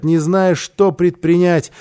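usual speaking voice

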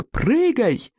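high, commanding order; high urgent order to jump. Acted. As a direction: bark it from the cliff. Plevaka repeats order.

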